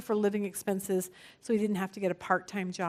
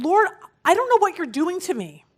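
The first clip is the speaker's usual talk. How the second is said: raised, higher-pitched retold outburst; mock yelling; 7 semitones higher, 5 dB louder than usual. Seminary student yelling at God by the lake, retold raised yell. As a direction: imitate crying out at God in protest, short of a scream. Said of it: "he starts screaming and saying".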